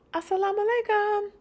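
put-on high, quick child's greeting. Girl answering the greeting, high and quick. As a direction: rattle it back like a little girl.

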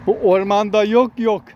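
normal talking voice

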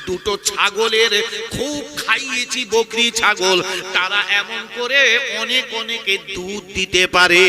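very loud, half-sung preacher's delivery; full-force preaching. Acted. Preacher retells the Prophet and Abu Bakr to the congregation, loud chanted preaching.

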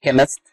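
chemist said kemist, with the h silent. Shown as a demonstration. Teacher to students on pronunciation, chemist with silent h. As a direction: say chemist starting with a hard k, no h sound. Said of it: "هنا ال H silent فبننطق ايه".

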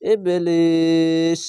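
stopping form ইবলিস, long held ee, slow; pausal recitation. Shown as a demonstration. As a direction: stretch ইবলিস with a three-count pull when stopping. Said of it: "তিন আলিফ টান হবে".

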